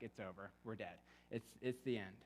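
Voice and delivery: sunk low and soft, doom-struck; doom-struck despair. Retold or imitated. Catastrophizer at the first raindrop, hushed despair. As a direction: mutter the end of the world into your chest.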